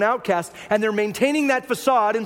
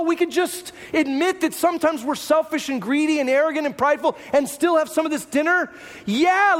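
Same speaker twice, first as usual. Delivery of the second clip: eager Pharisees; 6 semitones higher than usual. Pretend. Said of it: "taken off their masks and said".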